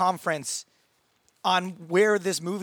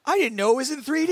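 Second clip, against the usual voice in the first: high-pitched mock whine; whining; 8 semitones higher, faster than usual. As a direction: imitate a complainer's whiny, raised protest. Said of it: "is whining about everything".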